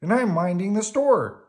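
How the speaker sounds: proud, high and loud; Bert. A narrator's reading. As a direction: announce importantly in a high puppet voice. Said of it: "Bert told her".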